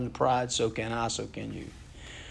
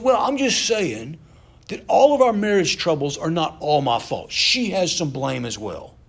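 defensive husband; 6 semitones higher, 7 dB louder than usual. Retold or imitated. Husband shifting blame for marriage troubles, mock defensive protest. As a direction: imitate a husband making excuses, loud and high at first, easing down. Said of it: "He said".

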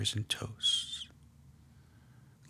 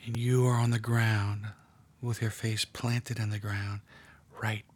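guided meditation. Acted. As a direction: draw them gently into the scene. Leads a guided prayer meditation.

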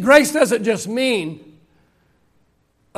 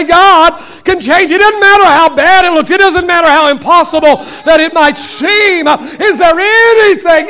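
rising, louder preacher's cry; preaching at full force; 7 semitones higher, 8 dB louder than usual. Acted. Preacher rousing the congregation, full preaching force. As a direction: build it up like a revival preacher.

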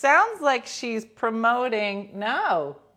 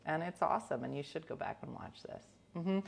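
usual speaking voice

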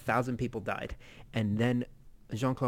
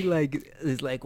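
usual speaking voice

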